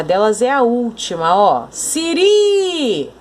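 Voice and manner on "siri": Siri with the final ri sung out high; stressed last syllable. Shown as a demonstration. Teacher to students, Siri with the tonic ri stressed high. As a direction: lift and stretch the ri of Siri. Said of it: "sílaba tônica é uri".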